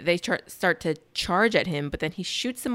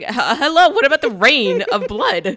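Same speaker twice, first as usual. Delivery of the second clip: louder, higher, half-laughing disbelief; mock alarm; 5 semitones higher, 9 dB louder than usual. Reacting to the film's blood rain, in loud laughing disbelief. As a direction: stammer at the blood rain like it's absurd. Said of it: "And I'm like".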